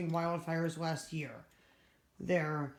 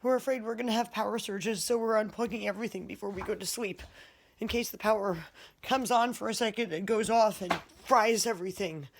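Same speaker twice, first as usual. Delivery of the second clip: usual speaking voice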